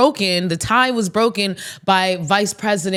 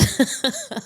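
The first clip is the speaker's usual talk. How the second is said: laughing; 13 semitones higher, 5 dB quieter than usual. Real, not acted. Laughs after her impression.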